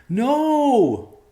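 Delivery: very high, loud scream; anguished yell. A narrator's reading. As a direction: scream it in horror. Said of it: "a yell came at the bedroom door".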